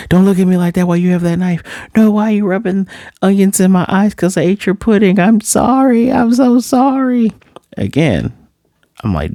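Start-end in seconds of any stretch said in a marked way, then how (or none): 0.1-7.3 much higher, pleading mock-frightened voice; panicked husband. Husband begging his knife-holding wife, high and pleading. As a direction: beg like a terrified husband. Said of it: "he's like".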